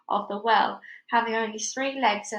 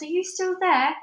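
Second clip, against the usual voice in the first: calling down; 8 semitones higher, 3 dB louder than usual. Acted. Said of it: "she called down there".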